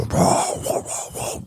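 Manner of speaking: breathy imitated dog huffing; voiceless mock dog bark; dog noise. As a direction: mimic a lunging dog's huffs. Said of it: "this dog was going".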